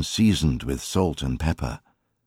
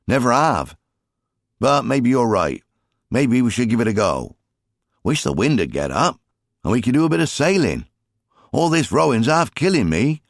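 higher, louder voice of a weary father; a higher, louder father half-joking about his wife's anger; rueful fisherman father; 8 semitones higher, 4 dB louder than usual. A narrator's reading. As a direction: grumble to your son while rowing hard. Jim voiced in story.

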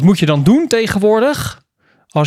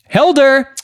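chatbotstem; 9 semitones higher, 6 dB louder than usual. Retold or imitated. Chatbot Daan voorgelezen.

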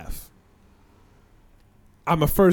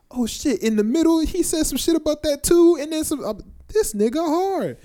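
hyped fan; 12 semitones higher than usual. Retold or imitated. Imitating a hyped battle-rap fan.

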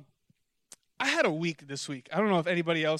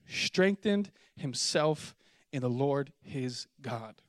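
slow emphatic reading; 4 semitones lower, much slower than usual. Shown as a demonstration. Preacher slowing down to stress the verse. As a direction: slowly lean on strengthened. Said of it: "watch this".

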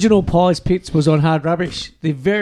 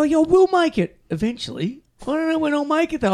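mimicking mate; 9 semitones higher, 5 dB quieter than usual. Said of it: "he keeps going".

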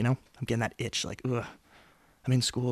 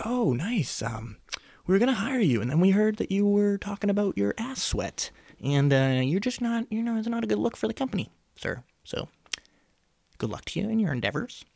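high, prim voice of a company rep turning him down; mock hiring manager; 9 semitones higher than usual. Mimicking a boss rejecting him over his ass sweat, prissy and high. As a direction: play the polite boss who just fired you. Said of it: "People are like".

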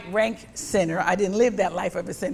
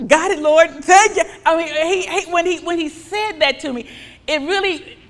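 louder and higher, laughing with joy; joyful preaching; 8 semitones higher, 9 dB louder than usual. Acted. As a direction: rejoice out loud before the congregation. Joyful preaching at full force.

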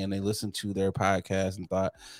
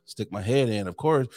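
ordinary talk, nothing marked

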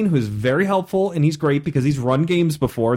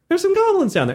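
much higher, squeaky reassurance; there's some goblins down there, pitched way up to coax; pleading repeat; 13 semitones higher, faster than usual. Retold or imitated.

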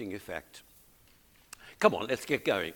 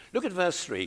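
normal talking voice

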